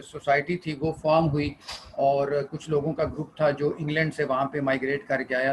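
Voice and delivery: normal talking voice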